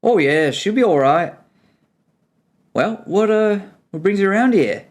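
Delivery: friendly Kiwi dwarf; Kiwi accent. Acted. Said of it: "This is my attempt at a Kiwi accent".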